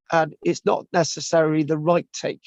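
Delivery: normal talking voice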